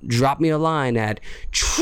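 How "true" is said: long high hoot; show-title holler. Pretend. Host plugging the podcast to listeners, hooted title.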